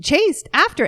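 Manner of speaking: hammed-up voice of a guard cracking up; imitating the laughing guard. Guard at the gate warns the runner, voiced high and loud. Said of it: "He's like".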